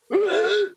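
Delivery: short, very high strangled shriek; high-pitched scream. Acted. Game master voices a scream in the fog, high shriek. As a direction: imitate a sudden scream of agony.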